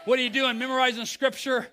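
ordinary talk, nothing marked

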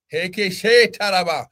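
ordinary talk, nothing marked

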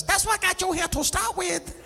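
high-pitched put-on doctor voice; Indian doctor. Indian doctor at the delivery to the mother, high put-on voice. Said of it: "the little Indian doctor looks down".

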